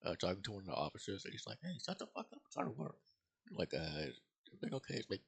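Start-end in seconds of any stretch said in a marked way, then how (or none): 1.6-3.0 high, testy mock cop snapping at a caller; imitating Taggart. Chief on the phone telling officer off, high mock annoyed voice. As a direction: play the grumpy chief cutting someone off.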